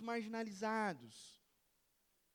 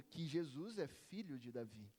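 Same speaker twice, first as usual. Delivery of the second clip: ordinary talk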